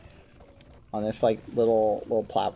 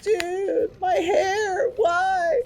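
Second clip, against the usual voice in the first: high, whiny mock wail; teasing him with a high fake whine; mock whiny complaint; much slower than usual. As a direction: wail like it's a tragedy, teasing. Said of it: "I did tease him".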